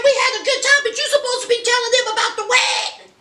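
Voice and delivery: high, fast, pushy prodding; Kenneth's urgent voice. Retold or imitated. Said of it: "He was like".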